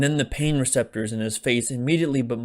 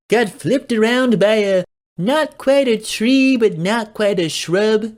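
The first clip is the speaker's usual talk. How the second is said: put-on excitable young guy; 9 semitones higher, 8 dB louder than usual. A narrator's reading. Tommy voiced, excited recap.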